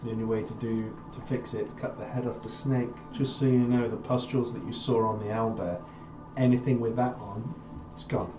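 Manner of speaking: grim in-character warning. Acted. Character warning companions about the pustules, grim and quiet. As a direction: give a soft, grim warning.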